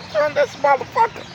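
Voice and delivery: high mock exasperation; exasperated. Narrator grumbling to Bunny Young at the pump, high exasperation. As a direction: toss it off high and incredulous.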